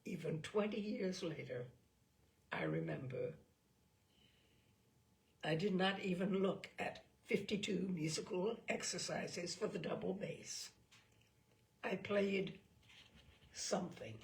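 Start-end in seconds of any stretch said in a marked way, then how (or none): none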